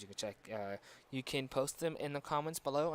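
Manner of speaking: ordinary talk, nothing marked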